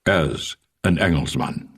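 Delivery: put-on understated Boer accent, wry; old Boer farmer. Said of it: "As one old farmer in Krugersdorp".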